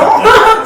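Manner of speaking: high, very loud laugh; short loud burst of laughter; real laugh. Real, not acted. Host says everyone has an accent, bursting into loud laughter. Amused talk about accents.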